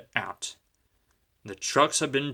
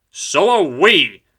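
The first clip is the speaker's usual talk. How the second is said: slow, louder hostile retort; hissed; 6 semitones higher, 11 dB louder, slower than usual. A narrator's reading. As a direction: hiss it back spitefully. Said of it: "hissed the engines".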